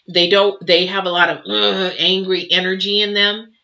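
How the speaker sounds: usual speaking voice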